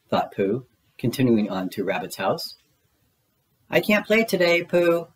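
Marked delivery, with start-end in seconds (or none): 3.7-5.1 high-pitched busy Rabbit voice; storybook rabbit, pitched up; Rabbit calling. A narrator's reading. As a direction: call out a refusal in a raised pitch. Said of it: "Rabbit called from his garden".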